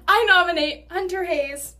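shaky, loud, still shivering. Real, not acted. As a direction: push words out while shivering. Right after the ice shock.

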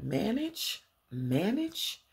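high rising disbelief on manage; mock outraged double question; incredulous. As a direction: repeat it in offended disbelief. Incredulous repeat.

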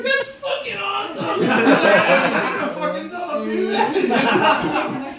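high-pitched imitation of a thrilled friend; retold amazed delight. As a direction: mimic a friend cracking up in amazement. Retold friend amazed, amid laughter.

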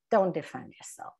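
normal talking voice

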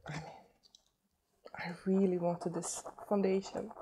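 whispered, moved by the gift; overwhelmed whisper. Real, not acted. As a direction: whisper it, overcome with gratitude.

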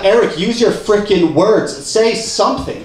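loud, high exasperated demand; acted exasperation. Confused climber to gym worker, exasperated.

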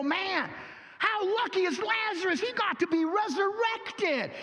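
high, eager mock admiration of Lazarus; envious churchgoers. Preacher mimicking people who envy Lazarus, high and eager. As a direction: play the wide-eyed envious believer. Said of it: "they go".

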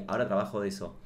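usual speaking voice

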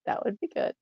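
soft whisper, almost voiceless; whispered aside. Acted. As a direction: whisper it to yourself. Whispered echo of own line.

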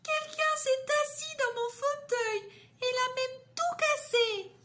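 voix très aiguë et plaintive de petit ourson; le petit ourson se plaint, tout aigu; petite voix d'ourson. Acted. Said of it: "Et le petit ourson dit". (Le petit ourson découvre son bol vide, voix très aiguë)

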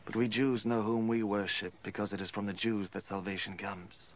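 ordinary delivery

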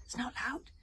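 anxious hushed call; nervous whisper. Acted. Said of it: "he whispered nervously".

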